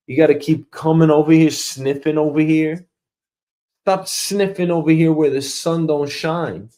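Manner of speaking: normal talking voice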